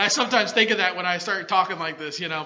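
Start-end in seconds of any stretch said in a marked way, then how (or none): none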